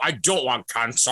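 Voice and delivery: hammed-up Scottish actor refusal; film-star impression, mock-serious; put-on reluctant star. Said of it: "He's like".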